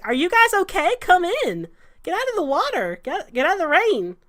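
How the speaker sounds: high, loud worried call; urgent calling. Acted. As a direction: hurry them in out of the storm. Salvador urging them inside.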